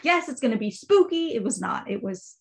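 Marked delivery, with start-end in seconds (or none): 0.0-1.3 high, eager mock anticipation; excited. Reader recalling hopes for a spooky book, excitedly. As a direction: replay your keen excitement, pitched up. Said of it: "I was so excited".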